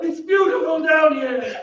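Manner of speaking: high, laughing and sobbing at once; unhinged laughing shout; raving laughing cry. Acted. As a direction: rave about the beauty like a madman. Phantom raving in the cellars.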